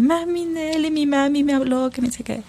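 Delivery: a hint of a whiny little girl; imitating her daughter. Mother imitating daughter complaining to grandmother. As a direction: mimic the child complaining. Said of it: "se fue donde mi mami".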